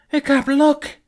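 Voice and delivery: high, loud urgent shout; urgent call. Acted. Astrid urging Hiccup to look, high loud shout. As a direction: yell it and point him there.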